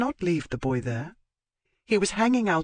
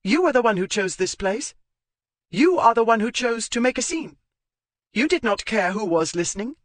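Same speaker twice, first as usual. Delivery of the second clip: higher and louder, indignant tirade; furious retort; 9 semitones higher, 5 dB louder than usual. Acted. Zoe berating Marchmont for making a scene, raised and furious. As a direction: lash back at him, voice raised.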